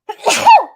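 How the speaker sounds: harsh coughing fit; sudden loud cough. Real, not acted. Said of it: "Don't laugh at me".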